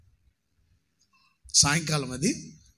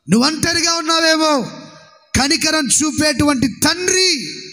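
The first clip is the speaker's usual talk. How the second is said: preaching at full force; 8 semitones higher, 9 dB louder than usual. Acted. Pastor blessing the lonely and fearful, full-force preaching. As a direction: pour it out over the congregation.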